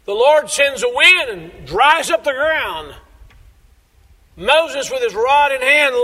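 high, loud, building sermon climax; preaching at full force. Acted. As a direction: preach it out to the back row, rising. Climactic retelling of sea crossing.

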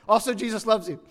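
laughing through the punchline. Real, not acted. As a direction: toss it off while laughing. Laughing tag to the joke.

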